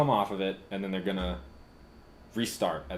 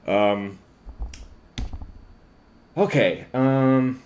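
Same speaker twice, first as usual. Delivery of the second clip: ordinary talk, nothing marked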